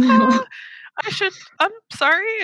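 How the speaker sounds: sheepish, high-pitched apology; embarrassed apology. Retold or imitated. Author apologizing to her son's friend's mother, embarrassed.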